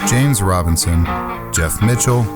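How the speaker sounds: ordinary talk, nothing marked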